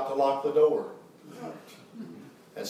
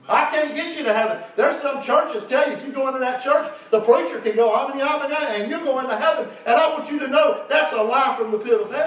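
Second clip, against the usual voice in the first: raised, higher-pitched pulpit preaching; fervent preaching; 10 semitones higher, 5 dB louder than usual. Acted. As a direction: preach it with urgent, driving conviction. Preaches at full force.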